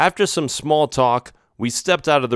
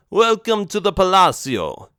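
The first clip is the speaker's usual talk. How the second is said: put-on higher mob boss greeting in a thick Italian accent; 8 semitones higher than usual. Vincenzo welcomes visitors, thick Italian accent. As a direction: roll it out like an Italian don. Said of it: "he said in a thick Italian accent".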